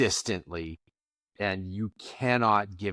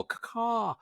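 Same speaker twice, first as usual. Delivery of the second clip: high squawking bird caw; eagle caw; 12 semitones higher, 4 dB quieter than usual. Retold or imitated. Eagle's return, imitated bird call.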